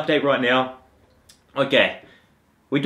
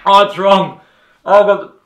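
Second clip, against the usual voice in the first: higher, loud pained cries from sourness; eating sour candy; 4 semitones higher, 5 dB louder than usual. Real, not acted. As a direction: wince through sour candy. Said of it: "everything hurts".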